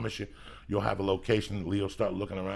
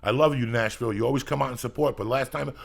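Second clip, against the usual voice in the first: ordinary delivery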